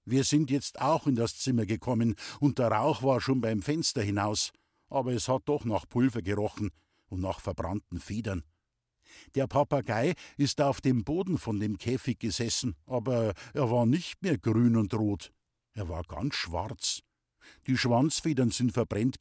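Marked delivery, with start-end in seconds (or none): none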